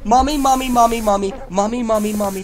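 put-on high childish calling; mock whimper for mommy. Gamer fleeing monster, mock childish crying for mommy. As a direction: whine for mommy like a scared kid.

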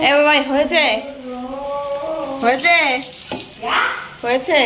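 real household shout through a door; calling out. Real, not acted. Calling son in the bathroom.

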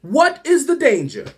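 ordinary talk

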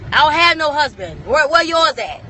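normal talking voice

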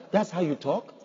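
disbelieving echo, rising at the end; incredulous question. Acted. Challenging people who excuse their talk, incredulous. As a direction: throw it back as a doubtful question.

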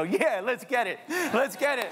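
high hype cheer. Retold or imitated. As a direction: cheer like a hyped friend. Cheering like a friend.

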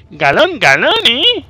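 mocking taunt. Mocking the hurt monster.

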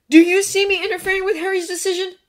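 high, fast, loud challenge; demanding. A narrator's reading. Draco challenging Hermione, demanding. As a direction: fire the question at her hotly. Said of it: "he demanded".

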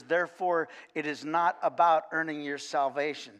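ordinary delivery

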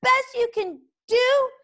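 high, stammering, gleeful taunts; taunting little dragon. A narrator's reading. Toothless mocking Fireworm with stuttered insults. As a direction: pile on the insults, high and cheeky.

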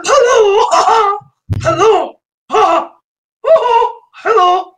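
high, excited singsong hellos with laughs; hammed-up greeting. Streamer cheerfully greeting his chat in a high voice. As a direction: belt out a giddy welcome.

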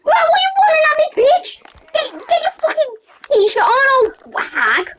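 high, rushed outburst; high-pitched indignant outcry; alarmed yell. Real, not acted. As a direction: yell it fast and high at someone charging at you. Yelling at player running at them.

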